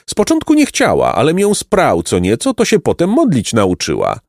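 high, lively farmer telling his tale; chatty peasant. A narrator's reading.

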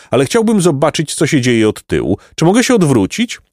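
inquisitive pompous donkey. A narrator's reading. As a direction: ask with self-important curiosity.

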